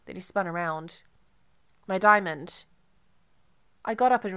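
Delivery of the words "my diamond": louder, sudden alarmed cry. A narrator's reading. Marco realizes his diamond is gone, alarmed louder cry. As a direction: blurt it as it hits him.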